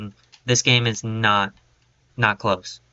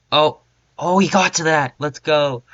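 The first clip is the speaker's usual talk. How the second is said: excited cheer; 4 semitones higher, 5 dB louder than usual. Real, not acted. Cheering a play in game.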